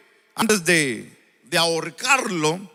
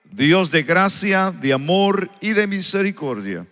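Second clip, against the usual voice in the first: prayer; 6 semitones lower than usual. Acted. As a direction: lead the congregation in prayer. Opening prayer before the sermon.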